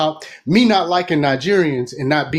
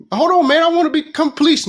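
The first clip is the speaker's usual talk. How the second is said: high pleading; 11 semitones higher than usual. Retold or imitated. The newcomer begging Nigerians for a job, high pleading. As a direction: plead like an eager outsider.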